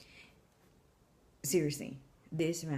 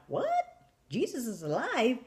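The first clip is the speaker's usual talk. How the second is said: higher, astonished question; shocked; 6 semitones higher, 4 dB louder than usual. Retold or imitated. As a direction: blurt it out in disbelief. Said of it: "I'm just shocked".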